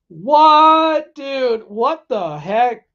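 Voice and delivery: high, loud, stretched-out "what" easing off; exclaimed disbelief. Real, not acted. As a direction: react in loud, incredulous protest. Reacting to a chat message.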